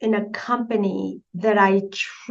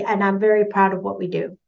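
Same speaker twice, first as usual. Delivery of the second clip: ordinary talk, nothing marked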